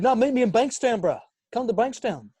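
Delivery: high, loud put-on lad; mock street lad. Prankster daring a kid to meet, high mock-tough lad. Acting his prank character's reply.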